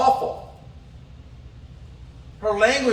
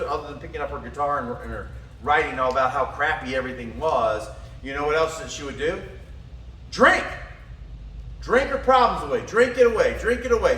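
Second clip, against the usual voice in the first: normal talking voice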